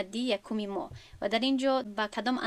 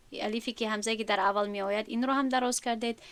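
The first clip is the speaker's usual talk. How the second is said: ordinary delivery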